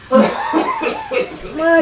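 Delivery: high squeaky laugh. Real, not acted. Laugh squeal after partner leaves.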